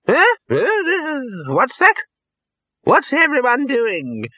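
very high, querulous waking voice; startled old Poons. A narrator's reading. Old wizard waking in wheelchair, startled. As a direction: jolt awake bewildered in a high pitch. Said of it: "suddenly waking up".

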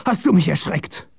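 high, shocked exclamation; startled cry. A narrator's reading. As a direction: scold from sheer fright. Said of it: "schrie er".

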